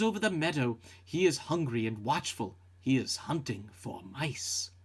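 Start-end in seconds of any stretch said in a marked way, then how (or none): none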